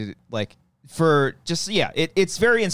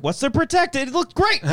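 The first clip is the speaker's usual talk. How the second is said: very high-pitched, incredulous mock director; acted-out high, excited protest; breezy director's enthusiasm; 3 dB louder than usual. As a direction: play a director delighted with a single take. Acting out director dismissing retake.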